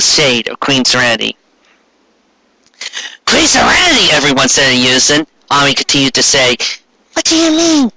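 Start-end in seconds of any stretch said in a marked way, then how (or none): 3.2-4.1 astonished cry. Acted. Everyone exclaims in unison. 7.1-7.9 put-on high, puzzled girlish question; high Ami voice. Ami asking Serenity.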